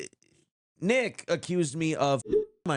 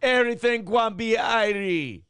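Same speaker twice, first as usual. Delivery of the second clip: slow, louder Jamaican patois; 7 semitones higher, 4 dB louder than usual. Acted. Frustrated and blowing off steam, loud slow patois. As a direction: lean into a lilting patois. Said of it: "I have to speak in patois".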